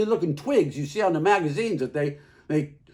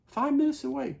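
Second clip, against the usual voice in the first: ordinary talk, nothing marked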